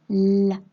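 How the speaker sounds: "la" opening on a long loud l; isolated sound. Shown as a demonstration. Teacher pointing out the l sound, held consonant. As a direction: hold the l at the start of "la". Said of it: "le son l".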